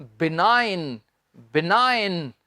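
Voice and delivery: बिनाइन said slowly, g silent; model pronunciation. Shown as a demonstration. Teacher modelling बिनाइन for students to repeat. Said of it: "बोलकर बताएं".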